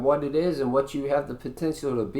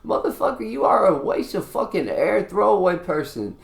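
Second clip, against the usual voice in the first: angry outburst; 5 semitones higher than usual. Real, not acted. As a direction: insult flung out much higher in pitch. Ranting insult at non-improvers.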